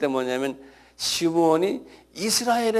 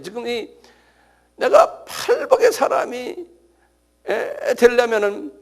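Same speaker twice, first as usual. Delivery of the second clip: ordinary talk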